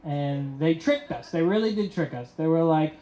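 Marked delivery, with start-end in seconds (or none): none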